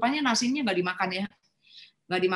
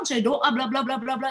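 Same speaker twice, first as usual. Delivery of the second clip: ordinary delivery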